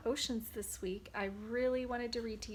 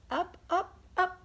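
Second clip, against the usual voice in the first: high and louder, floating up; rising up; 7 semitones higher, 7 dB louder than usual. Acted. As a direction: lift the voice like rising bubbles. Bubbles rising in read-aloud.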